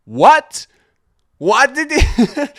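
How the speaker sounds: very high, loud, slow shriek of disbelief; outraged disbelief. Retold or imitated. Friend shrieking in disbelief at the balcony jump. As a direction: screech it in stunned outrage.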